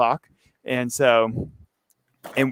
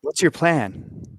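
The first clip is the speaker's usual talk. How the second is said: character voice; 3 semitones higher, 4 dB quieter, much slower than usual. Acted. S1 voices Taylor, slow.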